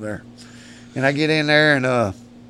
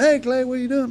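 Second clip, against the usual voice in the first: buddy's greeting; 12 semitones higher than usual. Retold or imitated. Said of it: "He said".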